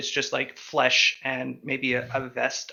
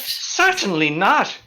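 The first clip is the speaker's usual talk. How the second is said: higher, louder, drawn-out indignant denial; 6 semitones higher, 6 dB louder, slower than usual. Acted. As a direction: deny it slowly, with offended emphasis. Character indignantly denies slavery involvement.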